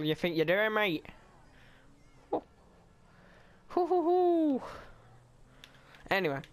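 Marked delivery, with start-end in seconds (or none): 2.3-4.6 high monkey hoots; chimp hooting. Pretend. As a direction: hoot like an excited chimp. Gamer hooting at NPC.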